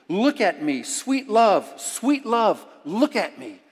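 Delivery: measured reading building to loud, impassioned plea; poem recitation. Acted. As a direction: recite slowly, swelling into a fervent prayer. Reads a poem aloud.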